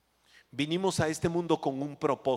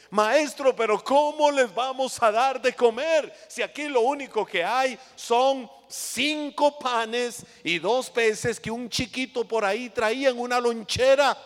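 protesting disciples; 7 semitones higher, 3 dB louder than usual. Acted.

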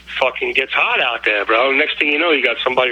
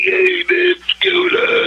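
high-pitched froggy dopehead impression; much slower than usual. Friend mimicking how dopeheads talk, in a froggy voice. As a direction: imitate a dope addict's croaky frog voice, pitched way up. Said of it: "get that froggy voice".

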